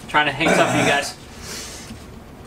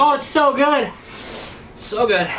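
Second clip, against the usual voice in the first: high delighted; 9 semitones higher, much slower than usual. Real, not acted. Savoring the hot wing.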